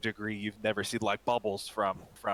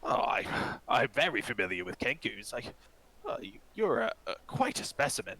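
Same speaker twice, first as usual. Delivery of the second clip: much higher, fluttery voice of a delighted eccentric; fascinated old elf; 4 semitones higher than usual. Acted. Elderly elven apothecary marvelling at the Kenku customer, high and excited. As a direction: gush like a scholar who found a rare specimen.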